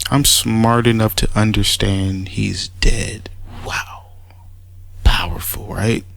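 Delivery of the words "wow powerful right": whispered mock awe; sarcastic whisper. Real, not acted. Mocking the rival's words with a sarcastic whisper. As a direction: breathe it in fake reverence.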